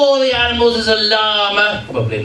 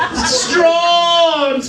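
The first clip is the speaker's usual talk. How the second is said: overacted shout; 8 semitones higher than usual. Storyteller hamming up the owner's grief for the audience. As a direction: overdo the grief, crying it out.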